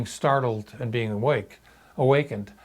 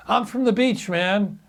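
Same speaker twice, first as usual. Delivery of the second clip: higher, dazed stoner drawl; spaced-out kid; 7 semitones higher than usual. Retold or imitated. Intruder answering the naked homeowner, spaced-out stoner voice. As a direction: play the spaced-out beach kid, lightly.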